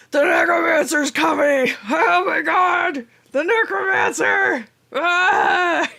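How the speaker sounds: frantic high yells of terror; panicked shouting. Acted. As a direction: scream it like fleeing monsters. Panicked bugbears fleeing necromancer.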